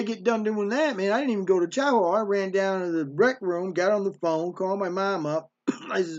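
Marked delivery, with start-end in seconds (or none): none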